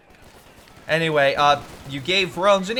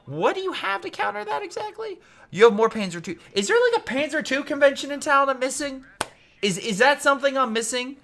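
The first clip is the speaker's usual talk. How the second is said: mock incredulous; 11 semitones higher than usual. Real, not acted. Caster mocks player's odd build.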